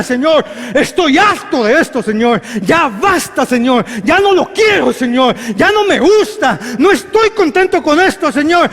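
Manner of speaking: high, loud fed-up cry to God; shouted prayer. Shown as a demonstration. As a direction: yell your frustration upward. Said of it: "grite y diga". (grita harto al Señor)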